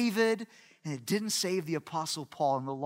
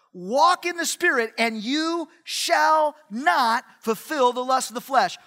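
raised, loud, measured proclamation; forceful scripture quote; 5 semitones higher, 5 dB louder than usual. Real, not acted. Preacher repeats Paul's promise to the room, forceful and emphatic. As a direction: drive the promise home word by word.

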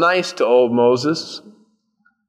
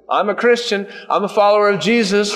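high-pitched, showy declaration; mock proclaiming; 7 semitones higher, faster than usual. Believer advertising their faith to others, mock proclaiming. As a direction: announce it proudly, a little hammed-up.

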